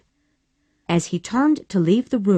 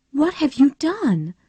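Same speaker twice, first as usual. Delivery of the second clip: anxiously; 9 semitones higher than usual. A narrator's reading. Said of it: "Melanie asked her husband anxiously".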